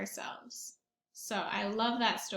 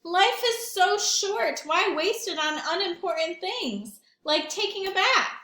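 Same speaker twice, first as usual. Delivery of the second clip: cheeky pigeon; 8 semitones higher, 7 dB louder than usual. Acted. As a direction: make it a lofty speech to dodge the bath. Pigeon philosophises.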